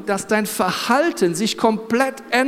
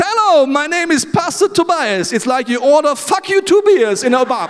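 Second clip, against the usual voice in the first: mock cheerful stage greeting; 6 semitones higher, 4 dB louder than usual. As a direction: greet the crowd full of confidence. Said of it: "kommt auf die Bühne und sagt". (übertrieben fröhliche Begrüßung auf Englisch)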